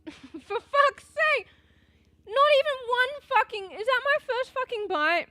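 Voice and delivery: yelling and laughing at once; laughing furious shout. Real, not acted. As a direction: bellow it in disbelief. Bit lip on first bite.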